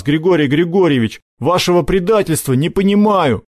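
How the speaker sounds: high-pitched, hurt reproach; indignant boy. Acted. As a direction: confront a friend who betrayed you. Boy reproaches his betrayer.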